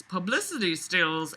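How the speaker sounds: usual speaking voice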